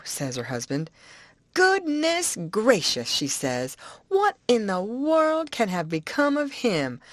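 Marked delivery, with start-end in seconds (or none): 1.5-3.1 put-on higher, eager old-woman voice; Aunt Sally's voice. Aunt to the boy, eager old-woman voice. 4.1-7.0 put-on higher, eager old-woman voice; Aunt Sally's voice. Aunt to the boy, eager old-woman voice.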